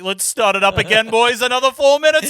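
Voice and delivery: loud, high, building showy call; mock rousing singer. She walks into frame restarting the song, loud rousing mock cheer. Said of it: "she's like".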